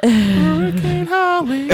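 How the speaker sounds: high, slow dramatic announcer call. Acted. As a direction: boom it out like a storm warning.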